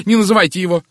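agitated, high and loud protest; frightened cry. A narrator's reading. Miss Howard begs Poirot not to name him, frightened cry. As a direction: plead loudly in alarm, pitch climbing. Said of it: "испуганно закричала мисс Говард".

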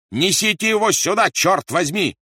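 loud, high cursing yell; angry shout. A narrator's reading. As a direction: bellow the order in fury. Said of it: "закричал Рольф". (громкий злой крик)

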